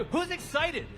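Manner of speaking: shouted hype to the crowd; excited. Real, not acted. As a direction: pump up the crowd. Hyping the crowd.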